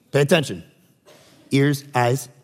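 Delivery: Sunday school talk. Acted. Preacher teasing sleepy congregation like Sunday school kids, soft and low. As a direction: coax little kids to listen, softly and low. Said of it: "that's Sunday school talk".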